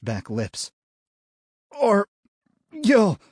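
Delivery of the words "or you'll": high, loud, strained gasps; wounded man forcing words out; pained gasping. A narrator's reading. Deputy weakening.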